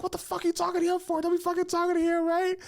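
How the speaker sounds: high, fast, squeaky mock of a jealous girlfriend. Ex-girlfriend scolding her boyfriend for talking to him, high mocking squeal. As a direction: mimic her shrieking jealousy in a cartoon falsetto.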